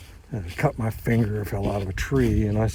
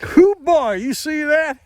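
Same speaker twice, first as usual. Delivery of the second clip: much higher and louder, whooping with glee; gleeful whoop; 6 dB louder, slower than usual. Retold or imitated. Drunk father bragging about hitting an animal, high gleeful whoop. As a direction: crow like he's thrilled at a kill. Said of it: "he'd be all excited like".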